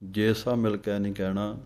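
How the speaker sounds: ਜੇ ਸਭ ਮਿਲ ਕੇ ਜੋੜ ਕੇ ਤੇਜ਼; ਸ਼ਬਦ ਜੋੜ ਕੇ ਗਲਤ ਢੰਗ; run together. Shown as a demonstration. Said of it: "ਵੱਖਰੇ ਵੱਖਰੇ ਬੋਲਣੇ ਹਨ". (ਜੁੜਿਆ ਗਲਤ ਉਚਾਰਨ)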